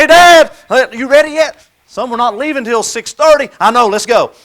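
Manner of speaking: put-on loud, high, impatient kid; eager son. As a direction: bounce with excitement to go golfing. Eager son before golf.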